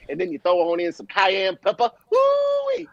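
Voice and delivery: Cajun TV chef, ending in a whoop way up high; Cajun chef catchphrase. Retold or imitated. TV chef quoted to friends, Cajun accent with a high woo-wee. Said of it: "he would say something along the lines of".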